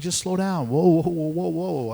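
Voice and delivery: retold plea to hold up; calling to slow down. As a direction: rein someone in with a string of whoas. Retold calling to a hurrying rival.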